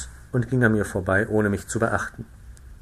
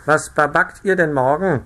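lightly voiced gossiping frog, higher and louder; 5 semitones higher, 6 dB louder than usual. A narrator's reading. One frog asks her neighbour about tomorrow's baking. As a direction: ask it like a nosy frog. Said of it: "fing die eine Fröschin zur andern an".

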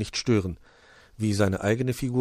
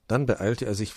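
plain reading voice

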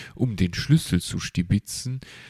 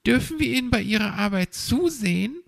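put-on eager child; 3 dB louder, faster than usual. The hedgehog child Borstel asks.

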